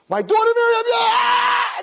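absurd high-pitched mock scream. Parent failing to yell with my daughter in it, mock screaming. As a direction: scream the relationship, breaking into a wordless yell. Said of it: "start screaming and yelling".

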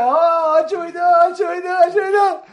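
high, loud repeated wail; mock panic. Reaction to the rally pressure, high mock-panicked clamour. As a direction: cry it out over and over, imitating a flustered reaction.

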